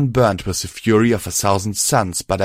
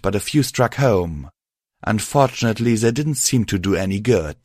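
plain reading voice

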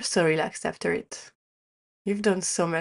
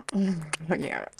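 whisper. Acted. Breathy moment in intimate roleplay.

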